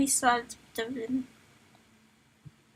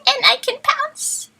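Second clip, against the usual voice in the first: slow high sneaky voice; 13 semitones higher, 5 dB louder, slower than usual. Acted. Voicing cat's thought dramatically.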